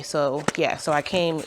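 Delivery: normal talking voice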